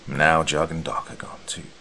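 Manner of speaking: whispered, trailing away at the end; voice dropping off. Acted. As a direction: let the words fade to almost nothing. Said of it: "his voice dropping off at the end".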